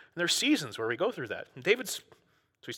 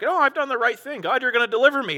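higher, bright confident claim; confident psalmist; 9 semitones higher, 5 dB louder than usual. Retold or imitated. Pastor acting David trusting God, higher pitch. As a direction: voice David sure of rescue.

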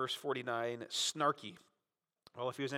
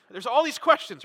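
excited; 9 semitones higher, 11 dB louder than usual. Real, not acted. Preacher stirring up the crowd.

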